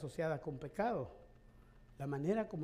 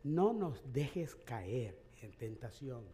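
"No nos dejes caer en tentación" said very slowly, every word given weight; slow down and lean on "dejes caer"; slow emphatic quote; 5 semitones lower, 3 dB quieter, much slower than usual. Shown as a demonstration. Pastor giving the better translation, slow and deliberate. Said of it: "sino que dicen".

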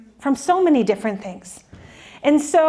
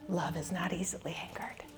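breathy whisper, much softer; whispered prayer; 4 semitones lower, 18 dB quieter than usual. Acted. A mom at the dishes whispering the verse to God. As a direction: whisper it through held-back anger. Praying at the dishes, holding anger back.

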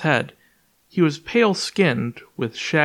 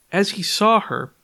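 usual speaking voice

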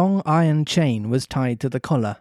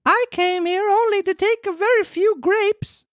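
much higher boy voice, a mock sob; Pinocchio sobbing. Pinocchio denying to the farmer, mock sobbing. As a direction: plead like a crying puppet.

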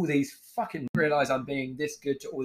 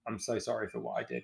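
soft, sheepish retold apology; 9 dB quieter than usual. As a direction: apologise quietly, like owning up to an old mate. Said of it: "sitting there all sheepish".

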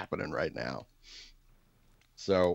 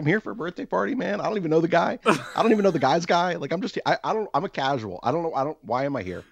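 high, rushed, panicky rant; hammed-up anxious babbling, much higher; mock panic; 11 semitones higher than usual. I'm an anxious person.

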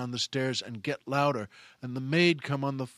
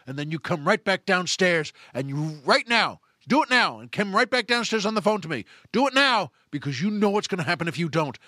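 emphatic orders bursting into shouts; acted furious commanding; madder and madder; 3 dB louder than usual. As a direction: drive each command home, then explode. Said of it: "make the guy madder and madder".

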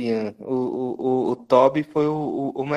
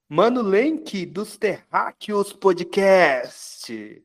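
slow, drawn-out hype announcer; announcer intro; 5 semitones higher, much slower than usual. Acted. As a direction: stretch it like a show host. Apresentando o convidado com pompa. (apresentador anunciando)

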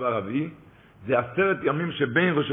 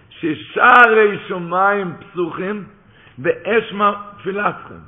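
emphatic preaching; 3 semitones higher, 5 dB louder, slower than usual. Real, not acted. Preacher quotes the midrash.

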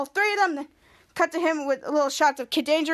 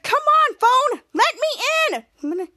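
high, drawn-out whiny plea; frustrated pleading; 9 semitones higher, 3 dB louder, much slower than usual. Retold or imitated. Piper locked out of the phone, high frustrated plea. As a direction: beg the phone, acting it out.

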